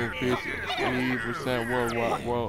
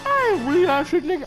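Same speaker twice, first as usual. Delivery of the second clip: high squeaky voice; 4 dB louder than usual. Pretend. High mock voice.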